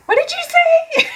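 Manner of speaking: very high, loud mock outrage; outraged challenge. Reader acting out turning back on a mutterer, outraged. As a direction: squeal it as an indignant comeback. Acted comeback to muttered insult.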